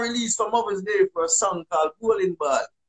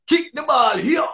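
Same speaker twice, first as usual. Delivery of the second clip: attempted English accent; 6 semitones higher, slower than usual. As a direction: call across the pitch, trying hard at an English accent. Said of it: "learn the English accent so bad".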